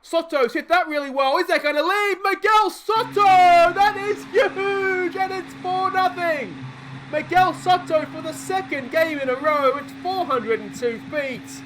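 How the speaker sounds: very high, loud, stretched-out shout; ecstatic home-run call. Real, not acted. Commentator calls Soto's huge home run, shouting in elation. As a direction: belt out the homer, drawing out the big words.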